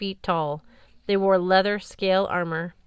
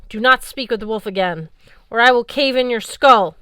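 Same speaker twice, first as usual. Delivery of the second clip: growing louder into a threat; threatening; 4 semitones higher, 8 dB louder than usual. Acted. Valkyrie captain menacing Magnus, building to a loud threat. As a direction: threaten to cave in his skull.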